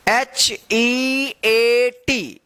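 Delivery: H E A T drawn out letter by letter; slow spelling. Shown as a demonstration. Teacher spells the word for learners, H E A T stretched slowly.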